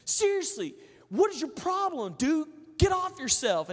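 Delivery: high, loud, exasperated shout; angry stranger. Retold or imitated. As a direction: yell at him with disgust. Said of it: "He goes".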